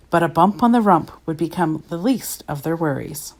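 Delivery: plain reading voice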